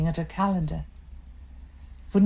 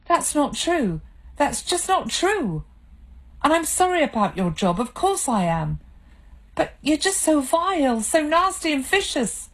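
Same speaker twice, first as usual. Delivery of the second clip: much higher, upset and hurt; tearful protest; 10 semitones higher than usual. Acted. Wife protesting through tears at her husband. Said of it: "She was starting to cry again".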